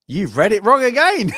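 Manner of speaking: gasp, then high, loud mock protest; acted outrage at the storyteller; indignant outburst. Acts out indignant child listener.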